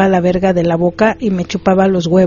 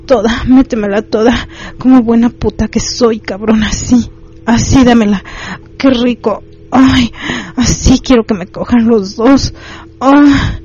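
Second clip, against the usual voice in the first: higher-pitched acted voice of pleasure; grateful moaning; 4 semitones higher, slower than usual. A narrator's reading. Narrator voicing the woman's thanks to the husband, moaning with pleasure. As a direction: moan the words eagerly, pitch lifted. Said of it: "ella agradecía diciendo".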